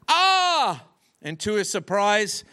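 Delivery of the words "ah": high, loud cry of "ah"; high-pitched yelp, acted out; startled shout. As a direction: cry out as if slipping on a mountain. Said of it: "the boy slipped and shouted".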